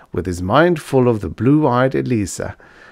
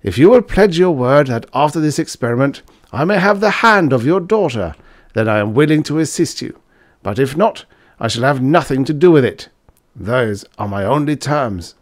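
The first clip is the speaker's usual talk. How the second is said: Fritz, louder and higher, laying down terms; determined student; 6 semitones higher, 5 dB louder than usual. Acted. Student demanding the professor's daughter's hand, firm and loud. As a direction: state the bargain firmly.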